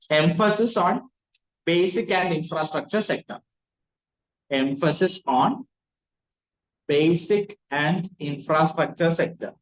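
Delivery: slow, deliberate phrase-by-phrase dictation. Acted. Teacher dictating notes to the class, slow and repeated. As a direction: dictate slowly and repeat each phrase for the note-takers.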